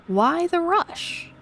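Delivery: high, slow, drawn-out tease; teasing caller. Acted. Caller toying with a keeper in a hurry, slow tease. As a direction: stretch it out playfully, pitched high.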